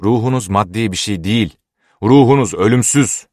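harsh, emphatic correction; stern. A narrator's reading. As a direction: insist sternly, driving up to the last phrase. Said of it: "dedi keşiş sertçe".

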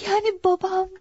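high, breathy near-sob; fearful, tearful. Acted. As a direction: ask it on the edge of tears. Daughter fears her father will die.

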